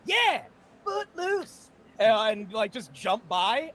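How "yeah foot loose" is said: high, slow cheering yell; teen dance whoop. Acted. Dancing teenagers jumping through the mall, whooping high.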